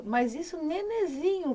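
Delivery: normal talking voice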